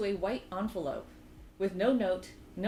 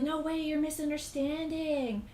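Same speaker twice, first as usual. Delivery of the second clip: higher, drawn-out mock yell; imitated yelling; 7 semitones higher than usual. Stalker calling after the woman he chases, mock yelling. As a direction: call it out like a mildly hammed-up pleading chaser. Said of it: "yelling at this while chasing after her".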